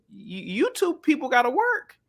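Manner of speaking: high-pitched mock queen; lightly voiced old queen giving orders; high, put-on scolding from a monarch; imitating the queen. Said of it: "the queen on her damn deathbed".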